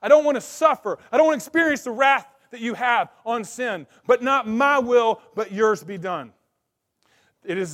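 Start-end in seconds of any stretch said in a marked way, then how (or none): 0.0-6.3 Jesus pleading. Acted. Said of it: "he says".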